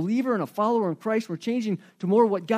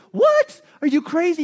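shrill high disbelief, much louder; incredulous; 7 semitones higher, 5 dB louder than usual. Retold or imitated. To the calm friend, a loud high cry of disbelief. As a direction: jump up in disbelief at their calm.

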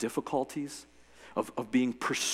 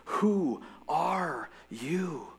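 put-on awed boy; 4 semitones higher, much slower than usual. A narrator's reading. Shasta asks the lion.

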